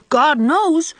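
slow, solemn "God knows" mimicking an older woman; imitating Mrs Lynde. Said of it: "just like that".

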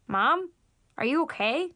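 talking through a mouthful; words round a bite of food; mouth full. Acted. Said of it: "I said through a mouthful of food".

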